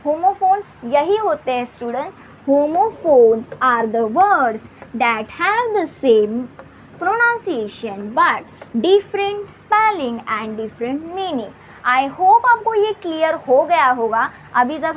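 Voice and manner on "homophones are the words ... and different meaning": very slow, word by word, as if for students to copy; slow dictation. Shown as a demonstration. Teacher dictating the definition to her students, very slow. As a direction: spell out the definition slowly, one word at a time.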